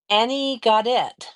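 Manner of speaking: usual speaking voice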